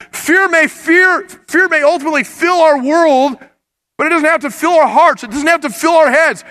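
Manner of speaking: loud, high, rising sermon peak; full-force preaching. Acted. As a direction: drive it home to the congregation. Preaching climax.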